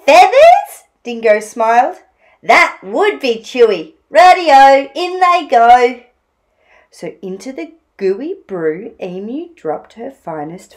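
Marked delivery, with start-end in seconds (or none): 0.1-0.8 high, loud rising query; hammed-up surprise; surprised Dingo. Dingo reacts to Emu's suggestion. 2.4-6.0 loud, eager call; animated story-time dingo; pleased Dingo. Acted. Dingo welcomes the suggestion.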